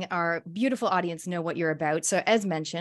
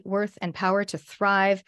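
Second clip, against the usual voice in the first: usual speaking voice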